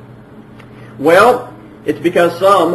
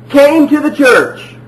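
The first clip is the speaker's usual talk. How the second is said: louder, higher, slow, proclaiming "came to the church"; mock misquote; 8 semitones higher, 5 dB louder, slower than usual. Shown as a demonstration. Preacher deliberately misreading scripture to his listeners, mock proclaiming. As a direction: ham up the wrong version. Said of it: "No, turned".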